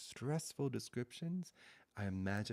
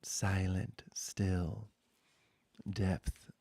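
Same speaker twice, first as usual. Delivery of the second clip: slow, hushed pointing-out litany, partly whispered; a spiritual teacher's slow, half-whispered refrain; contemplative litany; 6 dB louder, slower than usual. Acted. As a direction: let each word land in stillness. Meditative litany over objects.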